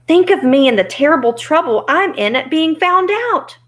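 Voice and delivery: high, loud self-pitying plea; pleading. Acted. Oz begs sympathy from his visitors, pleading.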